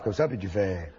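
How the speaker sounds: low, deep seductive voice; extremely deep bass voice. Shown as a demonstration. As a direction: drop the voice right down. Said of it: "tu prends ta voix la plus grave".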